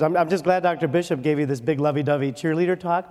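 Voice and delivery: normal talking voice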